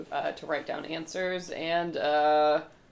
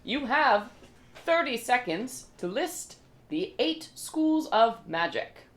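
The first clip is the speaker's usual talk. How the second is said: quiz host; 6 semitones higher than usual. Acted. MC reads the first question.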